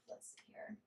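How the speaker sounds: whispered under her breath, to herself. Real, not acted. Drawing cards, talking to self.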